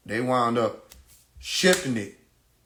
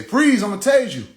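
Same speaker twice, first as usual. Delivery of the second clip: loud, high imitated cop shout; mock officer's shouted order; police command yell; 9 semitones higher, 8 dB louder than usual. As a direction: yell a warning at a fleeing suspect. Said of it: "They say".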